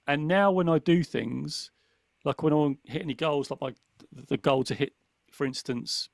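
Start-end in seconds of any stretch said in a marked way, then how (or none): none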